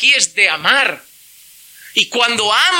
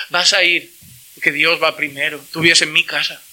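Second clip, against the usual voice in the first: padre firme; 6 semitones lower than usual. Retold or imitated. Padre firme, diálogo actuado.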